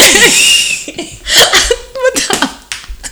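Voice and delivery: high, wheezy laughing fit; real laughing fit; helpless laughter. Real, not acted. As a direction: crack up, mostly breath. Cracks up after apologizing to guys.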